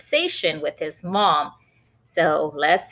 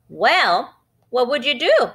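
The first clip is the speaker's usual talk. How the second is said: put-on cheeky little boy, higher and louder; Benny's voice; 7 semitones higher, 4 dB louder than usual. Boy Benny testing his mom, cheeky and high. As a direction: push her with a teasing question.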